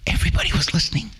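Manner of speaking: usual speaking voice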